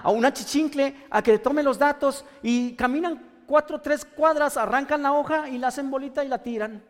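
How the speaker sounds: loud, driving sermon voice; fiery preaching. Acted. Pastor preaching to the congregation, loud and forceful.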